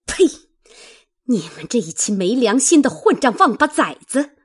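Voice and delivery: loud, fast, furious tirade; furious scolding. A narrator's reading. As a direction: spit it out and tear into him. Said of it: "骂道".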